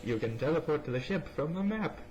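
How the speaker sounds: ordinary talk, nothing marked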